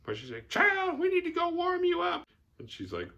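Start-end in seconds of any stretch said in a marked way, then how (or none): 0.5-2.3 put-on much higher, louder old-woman "Child, we need to go warm you up"; a caring old matron fussing. Bubba's mom to Mary Lee, fussing, high old-woman voice.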